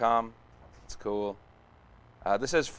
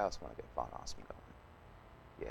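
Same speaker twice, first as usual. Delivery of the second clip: whispered to himself, much softer; 11 semitones lower, 16 dB quieter than usual. Real, not acted. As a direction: breathe it out as a private aside. Repeats the same thought under breath.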